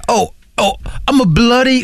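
high-pitched mock British tourist; British accent. Mocking the driver who hit the car, British accent. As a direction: put on a flustered old Brit's accent. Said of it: "an old guy with a British accent".

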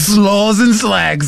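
high, very slow mock Brit; British accent. Echoing the co-host's tourist riff, British accent. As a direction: stretch it out in comic British. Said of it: "an old guy with a British accent".

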